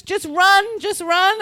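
high-pitched retold command; gate agent's urging. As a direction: urge someone on, imitating an outraged agent. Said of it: "She goes".

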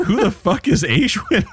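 high, far-off voice, baffled and rude; incredulous mock outburst. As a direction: play the friend who can't believe the name. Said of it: "Garrett's like".

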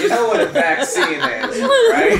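laughing. Real, not acted. Loud incredulous laughing.

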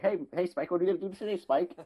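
high, yappy little dog voice; little prancing dog. Retold or imitated. Little dog pestering the big bulldog, high and yappy. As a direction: bounce around him like a cocky little pup. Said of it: "prancing around and be like".

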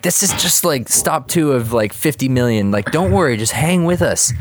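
high, insistent mock reassurance; eager coaxing. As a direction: imitate pals talking someone into staying. Friends coax Krabs along.

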